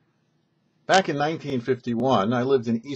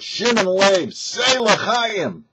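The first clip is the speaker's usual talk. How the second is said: much higher, louder call to drink; urging toast; 9 semitones higher, 5 dB louder, much slower than usual. Retold or imitated. The Rebbe calls the man to drink again, high and loud. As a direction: lift the voice high and insist on the toast.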